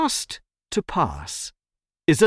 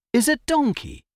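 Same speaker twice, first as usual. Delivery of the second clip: ordinary delivery, nothing marked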